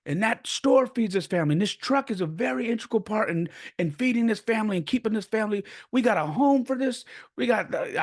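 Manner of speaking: high-pitched, imitated angry rant; worked-up mock tirade; grandfather losing it. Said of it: "freaking lost it on me".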